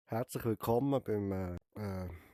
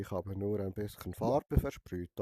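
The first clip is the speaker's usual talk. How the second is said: ordinary talk, nothing marked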